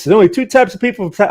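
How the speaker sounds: put-on high, fast joking Texas accent; hammed-up Texan; mock Texas accent. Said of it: "making fun of my, my Texas accent".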